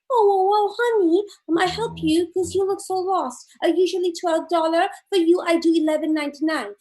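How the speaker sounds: put-on high, eager manicurist with accent; high nail salon lady. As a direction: gush over the customer, selling. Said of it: "the lady says".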